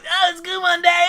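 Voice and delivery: put-on high, loud Southern kid voice; Gohan. Son answering dad at dinner, high Southern accent. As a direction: squeal it with a Southern twang. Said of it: "Why did I make him Southern?".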